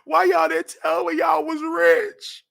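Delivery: high, slow, drawn-out amazement; amazed. Retold or imitated. To Jonathan's family, high slow amazement. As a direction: marvel at their pool, slowly. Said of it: "I said".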